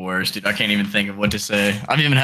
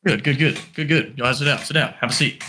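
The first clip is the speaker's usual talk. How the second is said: put-on girlfriend's dad voice. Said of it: "let's run more of a real scenario".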